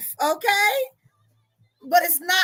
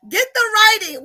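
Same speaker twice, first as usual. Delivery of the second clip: higher and louder, pressing the point; calling again to Nika Grace, higher and louder; louder repeat; 5 semitones higher, 6 dB louder than usual. Real, not acted. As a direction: say it again with more push.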